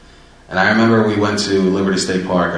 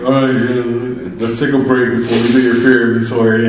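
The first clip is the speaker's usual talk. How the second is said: higher, hammed-up weepy voice; mock tearful; 5 semitones higher, 6 dB louder than usual. Sponsor calling a break after both cried, mock tearful. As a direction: imitate someone pulling themselves together after crying. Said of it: "And then we're both crying".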